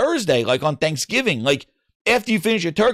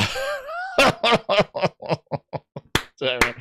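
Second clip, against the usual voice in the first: laughing; 6 semitones higher, 3 dB quieter than usual. Real, not acted. Laughs at own story.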